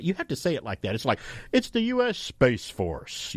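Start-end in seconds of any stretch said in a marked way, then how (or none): 1.5-3.3 big slow announcer read, each word weighted; dramatic announcer voice. Shown as a demonstration. Hosts joking about how the Space Force name must be said, slow announcer voice. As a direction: say it like a movie trailer voice. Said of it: "You have to lower your voice a little bit".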